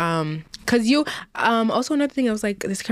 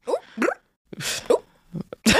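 [name] impression; 6 semitones higher, 3 dB quieter, slower than usual. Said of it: "It's Nicki".

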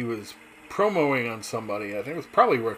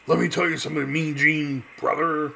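a hint of Hulk Hogan hyping up Mean Gene; lightly voiced wrestling bravado; mild Hulk Hogan impression; Hulk Hogan promo; 3 semitones higher, 3 dB louder than usual. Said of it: "Hulk Hogan did".

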